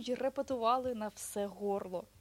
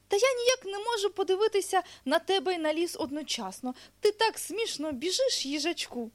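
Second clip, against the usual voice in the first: high, loud, animated storybook bear; bear protesting playfully; 9 semitones higher, 7 dB louder than usual. Acted. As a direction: protest in a lively little bear's voice.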